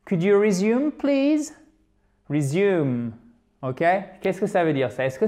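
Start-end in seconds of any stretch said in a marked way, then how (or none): 0.0-1.5 rising polite question; questioning example. Shown as a demonstration. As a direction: lift the pitch right up on "please". Models English example question.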